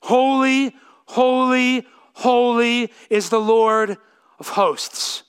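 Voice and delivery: each Holy lifted and weighted, slow; Holy, holy, holy said slow and weighty; three emphatic holys. Shown as a demonstration. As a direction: lean hard on every Holy. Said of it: "concentrate on verse three".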